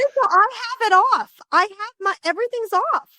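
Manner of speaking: real surprised protest, voice jumps up; flustered protest. Real, not acted. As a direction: caught off guard, laughing it off. Phone noise interrupts, she protests.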